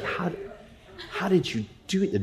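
high, soft, baffled question; a dumbfounded, hushed how; stunned disbelief. Retold or imitated. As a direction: ask it like you just saw a magic trick. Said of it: "I was like".

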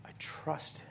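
a soft, near-whispered statement of trust; hushed faith. Retold or imitated. Preacher voicing a believer's quiet trust, whispered. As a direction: breathe it out quietly, barely voiced. Quoted faith after losses.

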